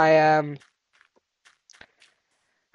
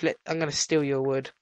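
normal talking voice

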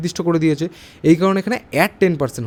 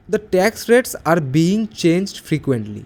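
slow dictation read; much slower than usual. Shown as a demonstration. Teacher reads exercise sentence.

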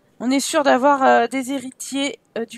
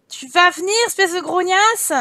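louder, higher annoyed summons; irritated raised voice; exasperated yell; 5 semitones higher, 6 dB louder than usual. Real, not acted. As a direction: snap impatiently at someone who won't come. Annoyed, calling the sim over.

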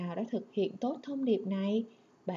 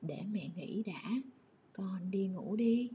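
tender mother; 3 dB quieter than usual. Shown as a demonstration. Mother tenderly tells Tuấn to go to sleep, whispered. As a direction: lull him gently to sleep. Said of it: "Tiếng mẹ âu yếm".

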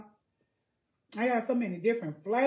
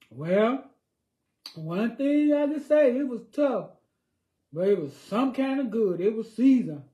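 mock verdict; 5 semitones higher, 9 dB louder than usual. Acting out viewers' verdict.

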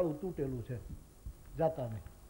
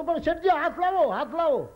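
loud, much higher pleading call; pleading shout; 10 semitones higher, 6 dB louder than usual. Retold or imitated. Rescuer begging the drowning merchant, loud high pleading shout. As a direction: beg him to give his hand. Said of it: "પેલો કે".

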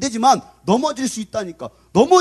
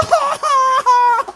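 high, loud fake wail; loud mock bawling; 8 dB louder than usual. Twenty-year-old crying after a fall, loud mock bawling. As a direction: bawl like a toddler, hammed up. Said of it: "거기서 막 울고 있어".

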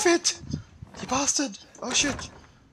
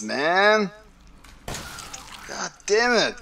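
usual speaking voice

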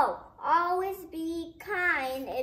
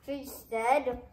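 ordinary delivery

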